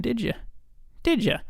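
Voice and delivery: high mocking taunt. Taunting his own mind.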